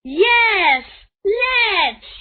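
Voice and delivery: high, loud happy shout; shouted back. A narrator's reading. Children answering Toto-chan, high loud happy shout. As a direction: shout back while hopping. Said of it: "they shouted back".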